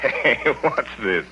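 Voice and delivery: slow, with a laugh in it; laughing fondly at his mother; gentle laughing tease. Acted. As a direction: chuckle at her softly. Son sees mother about to cry.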